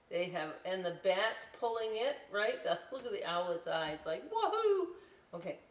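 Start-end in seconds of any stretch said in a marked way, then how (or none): 4.3-4.9 high loud whoop; cheering woohoo. Acted. Owlet cheering on the racetrack, loud woohoo. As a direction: cheer from the ride.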